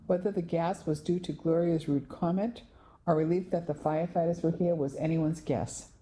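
plain reading voice

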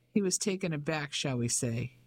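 ordinary delivery, nothing marked

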